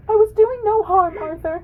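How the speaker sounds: high-pitched hog voice. Acted.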